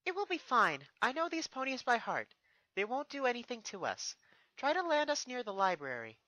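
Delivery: put-on upbeat young princess, pitched high; Twilight. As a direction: reassure the guard brightly. Twilight confident to guard.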